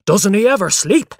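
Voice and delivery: loud, high-pitched exasperated demand; exasperated father. A narrator's reading. Father complaining about his sleepless son, exasperated. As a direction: complain loudly at the end of your patience.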